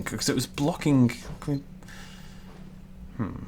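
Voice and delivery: ordinary talk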